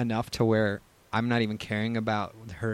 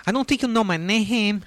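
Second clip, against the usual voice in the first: put-on East L A accent; 9 semitones higher, 4 dB louder than usual. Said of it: "let me hear your East L A accent".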